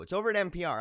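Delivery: ordinary delivery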